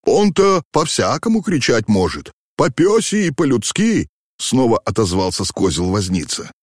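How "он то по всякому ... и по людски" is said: coachman. A narrator's reading. Coachman calls back from the box.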